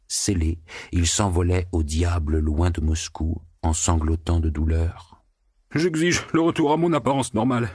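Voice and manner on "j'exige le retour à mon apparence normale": high, huffing, desperate demand; the pig demanding his old shape back; frantic pleading pig. A narrator's reading. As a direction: demand it while begging. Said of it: "grognant et soufflant, frénétique et suppliant".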